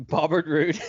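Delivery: laughs through the name Bobbert; laughing slip. Real, not acted. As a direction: giggle out Bobbert Roode.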